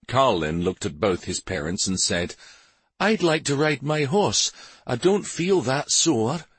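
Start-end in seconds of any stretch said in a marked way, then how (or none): 3.0-6.5 put-on high-pitched young boy; boy's voice. A narrator's reading. Son asking parents to ride his horse, boyish. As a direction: speak up high like an eager son.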